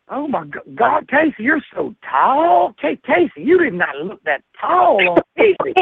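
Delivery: high, mock South Carolina twang, drawn out slow on "you're so tall"; Southern twang. [name] gushing at Casey by the pool, mock South Carolina twang. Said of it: "he got a twang on him".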